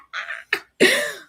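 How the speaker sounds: squeaky high laugh; high giggle. Real, not acted. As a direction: let out a high-pitched giggle. Laughs after the news.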